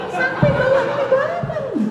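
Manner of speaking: high, loud, laughing through the words. Real, not acted. Wife laughing to the congregation about her husband, loud. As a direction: burst out laughing mid-line.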